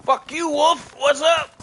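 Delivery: high, loud taunting yell; taunting shout. Real, not acted. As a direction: shout defiance at the wolf. Fighting the wolves.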